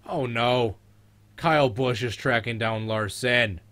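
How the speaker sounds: loud, high-pitched alarm at the race; scolding shout. Real, not acted. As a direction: shout it like shooing a cat. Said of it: "you sound like you're talking to a cat".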